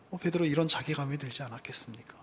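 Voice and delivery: hushed whisper; whispered rhetorical question. Real, not acted. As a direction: drop to a whisper. Whispered aside.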